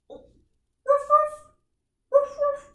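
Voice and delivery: short high woofs; dog bark. Acted. Teacher showing children what a dog says, high barking. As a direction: yap like an excited dog. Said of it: "What does a dog say?".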